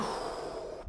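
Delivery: long slow breathy exhale through the mouth; showing the kids how to breathe out; demo exhale. Shown as a demonstration. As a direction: let all the air out in a long sigh. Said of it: "explaining the clam breathing".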